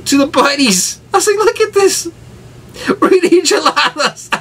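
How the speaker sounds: very high, strained words through laughter; real laughing to tears; crying with laughter. Real, not acted. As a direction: squeeze the words out between laughs.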